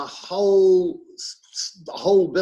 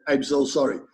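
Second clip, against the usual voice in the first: put-on Slovak accent, louder in the middle; mock Slovak accent; 3 semitones lower, 4 dB louder than usual. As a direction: play an apologetic Slovak broadband man, close to laughing. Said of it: "That was my Nostrovia voice".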